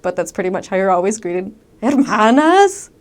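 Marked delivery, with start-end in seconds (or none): 1.8-2.9 loud, high, drawn-out greeting shout; angry-sounding yell. Retold or imitated. Northern Mexican greeting visiting sisters, angry-sounding yell. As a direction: stretch the word and holler it. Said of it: "And it sounds angry".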